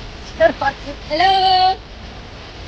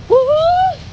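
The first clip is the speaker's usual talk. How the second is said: high joyful woohoo, much louder; excited whoop; 10 semitones higher, 6 dB louder than usual. Real, not acted. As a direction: whoop with delight at the view. Cheering at the beautiful bridge.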